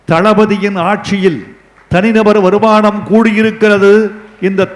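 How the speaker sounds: rally the crowd like a political speaker; உரத்த மேடைப் பேச்சு; rousing oratory. Acted. Rousing public speech to Tamils.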